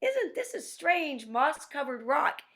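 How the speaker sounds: high, slow wondering spider voice; Anansi voice. Acted. Teacher voicing Anansi to the class, high and wondering. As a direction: marvel at the rock in a high trickster voice. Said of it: "and he goes".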